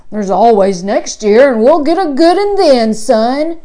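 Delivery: loud country uncle voice; Uncle E's voice. A narrator's reading. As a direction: comfort the boy in a big hearty voice. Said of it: "He said".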